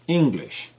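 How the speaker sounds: English punched on ENG, falling to a weak -lish; first-syllable stress. Shown as a demonstration. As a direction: hit ENG in English and let the end drop. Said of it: "bien à accentuer".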